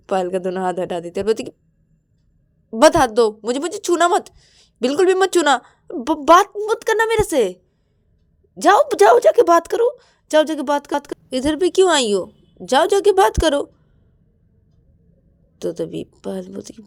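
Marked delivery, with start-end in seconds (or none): 2.7-13.6 high, loud furious rejection; angry shout. Acted. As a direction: push her away, yelling. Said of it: "इतना गुस्सा हो गया".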